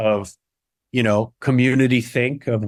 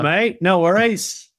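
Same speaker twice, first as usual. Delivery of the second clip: higher, silly Aussie accent; Australian accent; 6 semitones higher, slower than usual. Shown as a demonstration. Actor play-acting Aussie banter, silly high Australian accent. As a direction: toss it off in a broad Australian accent. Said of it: "I do, like, Australian".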